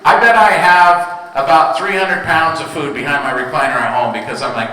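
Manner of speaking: normal talking voice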